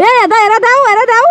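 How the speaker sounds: high, urgent calling out; excited. Real, not acted. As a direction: call after them excitedly. Kids grabbing and running off.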